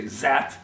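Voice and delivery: "zat" with a buzzed Z for TH. Shown as a demonstration. Showing the French version of that, "zat" with a Z. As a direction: say "zat", a Z replacing the TH. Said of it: "the French will say".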